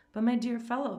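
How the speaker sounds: hurt host objecting, pitch lifted; mild, hurt protest. A narrator's reading. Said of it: "the general protested".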